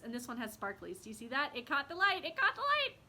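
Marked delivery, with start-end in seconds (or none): 2.2-3.0 much higher, louder squeal of delight; excited squeal. Real, not acted. Thrilled at the sparkles on her notebook, high excited squeal. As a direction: repeat it squeaking with joy. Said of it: "Oh, I get so excited".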